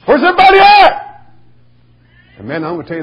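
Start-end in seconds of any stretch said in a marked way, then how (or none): 0.1-1.0 high, loud preacher's cry; shouted sermon title. Retold or imitated. Young preacher crying out his sermon title, loud and high. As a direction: call it out to the empty pews. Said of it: "preached like a wild man".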